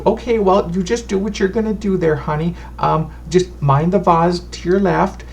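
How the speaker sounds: a sweet granny introducing herself; fussy old fortune teller. Acted. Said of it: "she goes".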